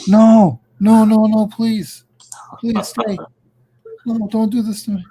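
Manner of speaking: high, desperate playful begging; mock pleading. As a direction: beg him to stay, hammed-up. Begging co-host not to leave.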